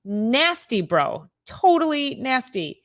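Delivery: stretched, high, playful disgust; mock disgust. Real, not acted. Teasing Kenny about pineapple on pizza, mock disgusted. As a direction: drag out "Totally nasty" teasingly.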